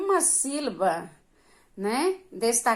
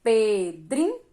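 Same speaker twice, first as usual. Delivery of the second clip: "Pedrinho" broken into three separate syllables; slower than usual. Shown as a demonstration. Teacher showing how to separate syllables, "Pedrinho" said piece by piece. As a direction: pull "Pedrinho" apart with pauses between syllables. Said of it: "Separe a sílaba".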